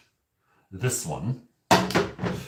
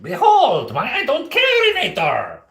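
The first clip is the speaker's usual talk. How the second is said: grand cartoon villain, much higher and louder; villain unveiling; 11 semitones higher, 6 dB louder than usual. Cartoon villain proudly unveiling his latest invention. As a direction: unveil the invention like a mad scientist.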